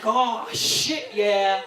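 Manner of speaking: loud, triumphant exclamation; mock elated cheer. Employee told shoes will be reimbursed, loud retold elation. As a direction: burst out in celebration, punching "yeah".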